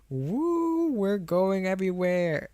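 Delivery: high, drawn-out mock whoop; long swooping playful "woo". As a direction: stretch it out in a high, silly cheer. Playfully calling out viewer's long name.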